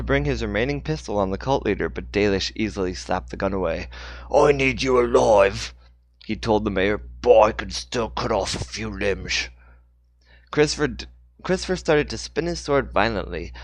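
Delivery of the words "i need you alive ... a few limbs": menacing villain. Acted. Cult leader threatening mayor.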